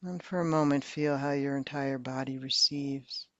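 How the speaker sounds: ordinary delivery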